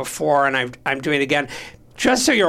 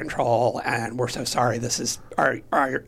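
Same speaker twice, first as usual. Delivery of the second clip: gentle police reassurance; 5 semitones lower than usual. Retold or imitated. Police to patient's crying child, gentle reassurance.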